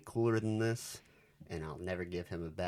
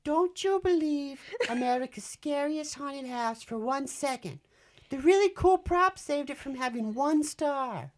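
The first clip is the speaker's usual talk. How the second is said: much higher, nasal-free nerd voice, reading a review; nerdy reviewer voice, much higher and a bit louder; 6 dB louder than usual. Retold or imitated. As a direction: play a squeaky nerdy reviewer. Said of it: "Do it like a nerdy voice".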